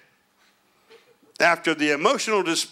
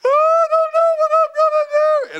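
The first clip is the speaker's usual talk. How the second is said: high falsetto fake sob, much louder; exaggerated teary wail, pitched way up; mock crying woman; 7 dB louder than usual. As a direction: whine it like a weepy wife in a hammed-up cry. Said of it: "she goes into the crying mode".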